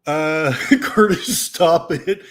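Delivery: real laughter breaking into the words; laughing hard. Real, not acted. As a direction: laugh through a name and a plea. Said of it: "I'm laughing so hard".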